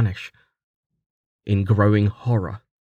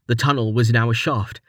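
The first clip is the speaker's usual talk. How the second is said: ordinary delivery, nothing marked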